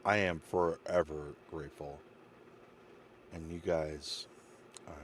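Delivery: emotional. Real, not acted. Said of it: "I'm getting emotional again".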